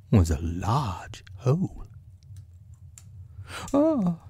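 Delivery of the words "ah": very high storybook bear; little "ah" in a high-pitched bear voice. Acted. Bear pondering a hole he has found, high character voice. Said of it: "said Pooh".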